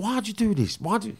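high, disbelieving why; retold baffled demand; incredulous question. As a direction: ask it like you can't believe it. Retelling his stunned question to Mick.

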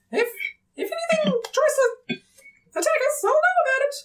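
high squeaky little creature voice; Spritey's voice. Acted. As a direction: pitch way up as a tiny sprite.

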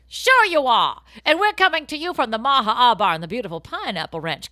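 loud cheesy podcast host open; announcer intro. Acted. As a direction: boom it out like a show host.

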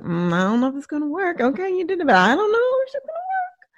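a fretful little inner voice, almost tearful; doubting inner voice. Pretend. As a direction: play the nagging doubt in your head. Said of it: "some part of you that's like".

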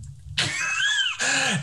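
a burst of high laughter. Real, not acted. Remembering the night, a burst of high laughter. Laugh while telling story.

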